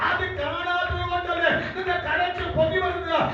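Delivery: high, driving revival preacher shout; impassioned pulpit proclamation in a sustained high voice; full-force preaching. Acted. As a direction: declare it to the congregation at full cry.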